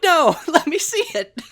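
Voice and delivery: high, loud laughing protest; a mock plea to the author, laughing. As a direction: whine it out through a laugh.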